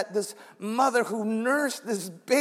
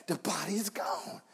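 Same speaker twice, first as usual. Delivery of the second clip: breathless whisper of the news; 5 semitones lower, 11 dB quieter than usual. Retold or imitated. Peter whispering to John that the body is gone. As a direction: hush it like a secret. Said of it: "It said".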